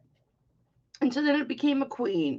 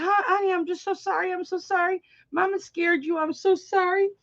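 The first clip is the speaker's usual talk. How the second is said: soothing mom; 9 semitones higher than usual. Retold or imitated. Mother soothing her startled toddler.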